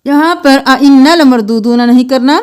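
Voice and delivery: slow, melodic tajweed chanting; Quran recitation. Acted. Teacher chanting the verses for students, melodic recitation. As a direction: intone each verse with care.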